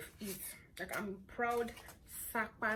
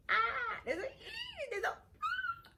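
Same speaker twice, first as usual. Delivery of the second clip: each line jumps far higher, ending in a laugh; rising squeaky notes; 4 dB louder, slower than usual. Shown as a demonstration. Showing fans her lost high notes, climbing squeaks. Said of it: "I'm so sorry about my voice".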